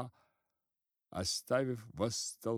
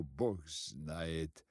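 ordinary delivery

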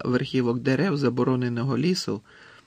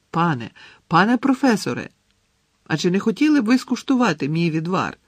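high, loud calling after someone; raised voice, pleading desperately; 6 semitones higher, 5 dB louder than usual. A narrator's reading. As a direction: plead urgently as he walks away. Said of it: "розпачливо гукнув йому вслід".

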